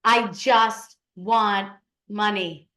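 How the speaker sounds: slow, word-by-word mock demand; mock insistent client. Consultant mimics her clients cutting her off, slow mock insistence. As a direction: imitate a client insisting, stretching each word.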